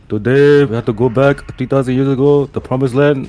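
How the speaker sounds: put-on spoof an Israeli spokesman's accent.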